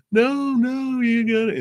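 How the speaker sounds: put-on fussing child. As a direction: squeak it like a small upset kid. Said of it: "And they're like".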